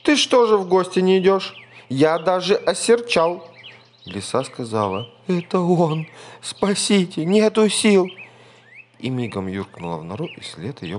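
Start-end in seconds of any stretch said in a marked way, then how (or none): 0.0-3.5 high, loud, fast scolding; mock-offended mouse. A narrator's reading. Mouse scolds fox playfully. 5.3-8.1 high, loud terrified cry; panicked fox. A narrator's reading.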